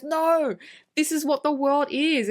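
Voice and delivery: mock impassioned protest; retold insistence. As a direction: imitate someone arguing the point firmly. Retold insistent argument with Saul.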